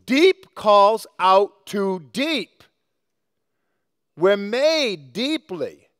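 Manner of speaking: drawn out very slowly for weight; slow solemn emphasis; slow, weighty. Real, not acted. Preacher stresses key phrase.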